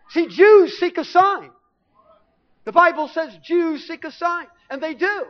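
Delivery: high, loud and slow preacher's proclamation; preaching. Acted. Preacher telling the congregation, in full proclamation. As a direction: proclaim it from the pulpit.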